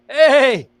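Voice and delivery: loud, high taunting goodbye; playful shouted send-off; mocking farewell. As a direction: wave them off with a sneer. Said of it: "and be like".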